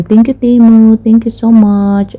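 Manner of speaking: thanking Timo with a loud, bright burst; excited shout-out thanks to the app. Real, not acted.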